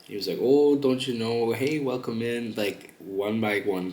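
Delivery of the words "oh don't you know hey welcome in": louder, drawn-out Canadian greeting; friendly Canadian accent. Retold or imitated. Imitating a Canadian streamer welcoming viewers in, friendly Canadian accent. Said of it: "the most friendliest Canadian man".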